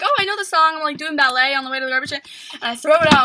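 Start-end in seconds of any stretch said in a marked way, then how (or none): 0.0-0.8 excited. Retold or imitated.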